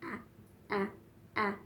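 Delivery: quick clipped separate ahs; three short bursts of ah. Shown as a demonstration. Therapist showing parents a short sound, clipped. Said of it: "make it short".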